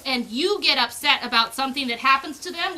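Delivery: ordinary delivery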